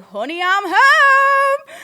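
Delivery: high, loud, long drawn-out call; drunken holler. Retold or imitated. Drunk boyfriend walks in on fuming girlfriend, hollering. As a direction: bellow it like an oblivious drunk, stretching the last word. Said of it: "bladdered, and was like".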